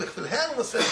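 usual speaking voice

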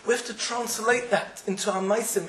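ordinary talk

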